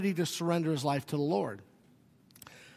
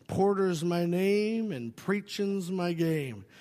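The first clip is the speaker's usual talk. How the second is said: slow, playful, every word stretched; drawn-out catchphrase; much slower than usual. Retold or imitated. To a dying friend, a slow teasing catchphrase. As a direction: bring the old joke back, slowly. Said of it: "And I went".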